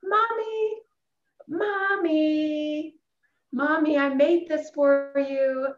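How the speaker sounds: put-on high, bright child's voice calling Mommy, rising to a sung plea; a small girl pleading for her mom's attention with her gift; excited little girl. Little daughter showing her mom an egg, high excited child calling. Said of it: "she's so excited. She says".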